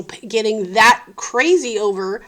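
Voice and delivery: ordinary talk, nothing marked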